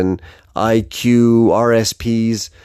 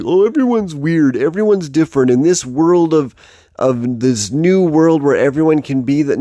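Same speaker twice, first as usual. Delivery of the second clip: higher-pitched mock chorus of everyone; imitating everyone; 5 semitones higher than usual. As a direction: mimic what people always say, pitched up. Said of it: "everyone's like".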